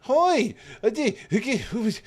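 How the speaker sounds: high, loud flustered hellos; overexcited high-pitched welcome; excited greeting. Real, not acted. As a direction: squeal hellos at surprise guests. Greets a crowd of surprise raiders.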